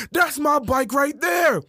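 drawn-out high-pitched shout; excited call-out. Acted. Travis spots his stolen bike, excited call-out. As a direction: shout it out on spotting it.

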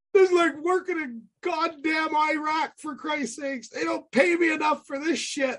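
high, frantic complaining outburst; panicked carpenter. Retold or imitated. Carpenter bailing on the job, high panicked griping. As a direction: whine like a scared worker bailing out. Scared carpenter quitting, retold.

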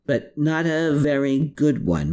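mildly wry comeback; quipping. A narrator's reading. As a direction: toss it back as a quip. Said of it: "Molly quipped".

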